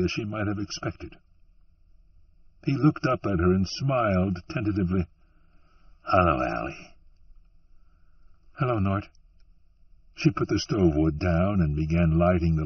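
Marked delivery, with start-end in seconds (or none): 6.0-6.9 Nort's voice. Acted. Nort greets Allie in story.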